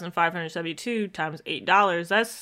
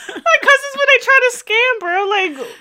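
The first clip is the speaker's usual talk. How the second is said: much higher and a bit louder, a comic squeak; squeaky high voice; 7 dB louder than usual. Pretend. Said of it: "why are you talking like this?".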